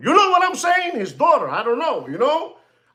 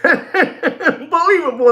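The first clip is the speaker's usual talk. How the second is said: laughing while saying Unbelievable, slow and high; 8 semitones higher than usual. Real, not acted. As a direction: laugh through the word in disbelief. Laughs at the watch.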